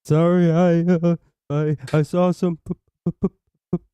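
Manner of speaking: a hammed-up stuttering caller voice; mock stammering caller. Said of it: "if you sound like that".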